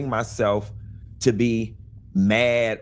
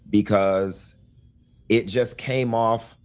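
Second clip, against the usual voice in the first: normal talking voice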